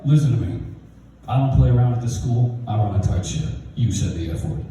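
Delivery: low, firm principal voice; stern principal. Retold or imitated. Principal warning a pupil who denies it, sternly. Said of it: "He's like".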